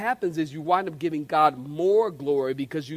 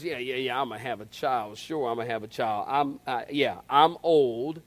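sarcastic Sarah; 4 semitones lower than usual. Acted. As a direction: mutter it in disbelief. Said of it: "when Sarah begins to laugh, she goes".

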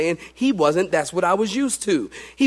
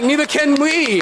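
audience retort; 9 semitones higher, 5 dB louder than usual. Retold or imitated. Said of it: "you're like".

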